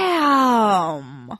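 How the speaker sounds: loud, long drawn-out, falling exclamation; imitated stunned awe. Co-host acting her reaction to the ending, stunned. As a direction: stretch it out in amazement.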